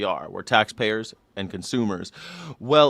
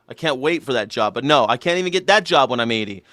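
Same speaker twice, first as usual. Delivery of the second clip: usual speaking voice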